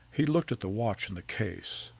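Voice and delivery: ordinary delivery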